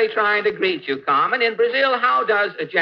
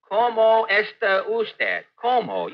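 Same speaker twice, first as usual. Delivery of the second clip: slow repeat; 4 semitones higher, slower than usual. Shown as a demonstration. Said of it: "Would you repeat that, please?".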